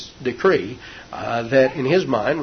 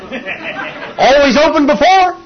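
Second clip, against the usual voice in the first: laughing; 12 semitones higher, 5 dB louder, slower than usual. Acted. Laughs at his own joke.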